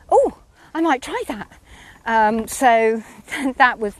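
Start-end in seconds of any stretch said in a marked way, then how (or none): 0.1-1.5 higher-pitched retold "oh", mildly intrigued; lightly surprised quoted reply; intrigued reaction. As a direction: act out someone taken with a new idea.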